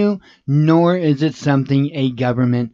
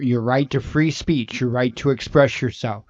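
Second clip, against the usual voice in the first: normal talking voice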